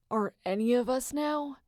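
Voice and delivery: ordinary delivery, nothing marked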